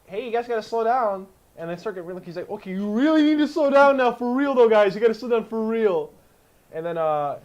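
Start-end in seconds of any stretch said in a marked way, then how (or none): 0.1-1.3 mock controller hailing a ship, high and loud; raised voice, warn them over the radio; loud, higher-pitched acted warning; urgent calling out. Acts ground control calling out. 2.5-6.1 high, very loud mock panic warning; acted frantic shout; frantic yelling. Acts controller growing frantic.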